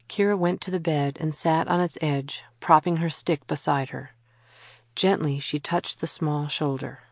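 plain reading voice

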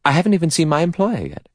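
fast, high-pitched addition; quick. A narrator's reading. Secretary clarifies her position to stranger, quickly. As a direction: get it in promptly. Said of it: "She added quickly".

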